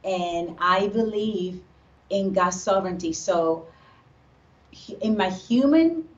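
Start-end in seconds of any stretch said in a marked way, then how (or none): none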